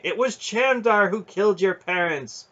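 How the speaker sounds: high, loud revelation; ringing voice. A narrator's reading. Aunt telling the boy who killed his parents, ringing. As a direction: hurl the accusation out. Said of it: "Aunt Paul's voice rang".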